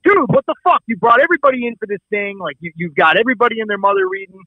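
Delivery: heated, high-pitched rant retold; laying into him. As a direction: tear into a friend in exasperation. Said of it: "I just laid into him".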